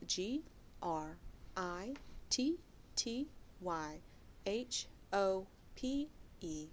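each letter spelled out slowly; slow spelling. Shown as a demonstration. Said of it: "slow spelling of that".

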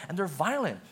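normal talking voice

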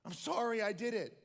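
high, pleading, tearful sorry; tearful apology. Acted. Daughter running into her father's arms, tearful and pleading. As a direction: cry it out as she runs to him. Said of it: "rises up with joy and tears".

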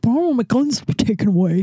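whiny mock voice of a gun owner. Mocking gun owners who whine about their guns. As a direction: sneer it like a whining idiot. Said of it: "fucking idiots who are like".

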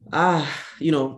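ordinary talk, nothing marked

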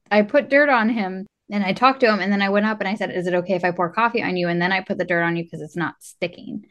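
usual speaking voice

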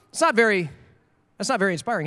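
usual speaking voice

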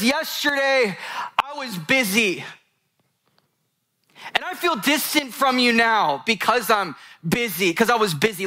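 high-pitched retelling of a crying daughter; imitated tearful confession. Crying daughter apologizes to her dad in the kitchen, imitated plea. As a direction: voice an upset daughter, pitched well up. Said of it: "she's crying to her dad".

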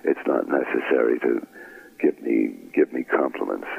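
low, modest brush-off; poo-pooing dismissal. Retold or imitated. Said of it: "he just poo-pooed it".

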